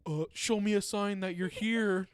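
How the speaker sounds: loud, high mock summoning of spirits; calling out to a ghost. Sister filming ghost videos, calling loudly to spirits. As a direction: call out to the ghost like a hammed-up ghost hunter. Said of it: "And I would say".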